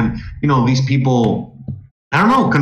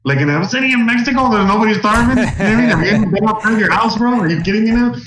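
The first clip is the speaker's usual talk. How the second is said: higher-pitched, worked-up disbelief; calling out a smug commenter with raised-pitch disbelief; indignant retort; 6 semitones higher than usual. Retold or imitated. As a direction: replay the outrage at a smug online critic. Retelling his indignant reply.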